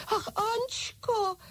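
very high, drawn-out plea; slow, high cry of a little French doll. A narrator's reading. French doll appeals to her leader in alarm, high-pitched cry. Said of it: "křičela malá Francouzka".

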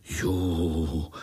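drawn-out, understated awed ooh; a mild ooh of little spiders; chorused wonder. A narrator's reading. Little spiders react in chorus, mild wonder. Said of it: "řekli pavoučci sborem".

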